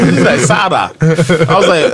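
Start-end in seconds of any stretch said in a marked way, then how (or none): 0.4-0.9 "Sada" with a tapped d-like sound for the r; mock-accented "Sada"; imitated accented name. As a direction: mimic an accent on "Sada", falling at the end. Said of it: "You can't be imitating people".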